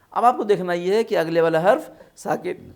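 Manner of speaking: normal talking voice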